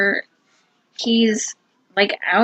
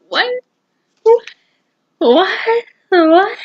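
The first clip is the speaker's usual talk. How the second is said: disbelieving what; 8 semitones higher, 4 dB louder than usual. Real, not acted. Plot twist disbelief.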